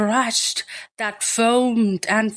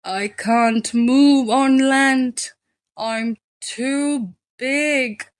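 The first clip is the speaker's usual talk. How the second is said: slow, strained moan, lightly voiced whale; drawn-out helpless moan from a big creature; 4 semitones higher, slower than usual. A narrator's reading. Beached whale moans to the snail, slow and despairing. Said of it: "moaned the whale".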